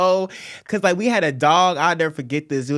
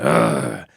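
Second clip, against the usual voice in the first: very low, guttural mock grunt; imitated grunt. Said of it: "like, a grunt".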